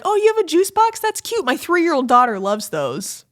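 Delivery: high, mocking friend voice; high sing-song mimicry of a friend. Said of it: "one of your friends was like".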